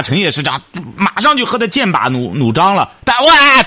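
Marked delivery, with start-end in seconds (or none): 3.2-3.6 mock wail, loud and high; 模仿大哭. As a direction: burst out in a put-on "waah" of crying. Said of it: "哭给你看了". (夸张地学人哇哇哭)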